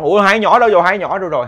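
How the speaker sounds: high, loud panicked question; alarmed cry. Retold or imitated. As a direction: cry out for the missing kids. Parents find children missing.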